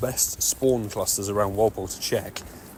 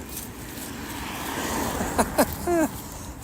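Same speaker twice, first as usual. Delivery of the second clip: high delighted laugh; 5 dB quieter than usual. Real, not acted. Laughing after triple shiny.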